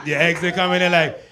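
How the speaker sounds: ordinary talk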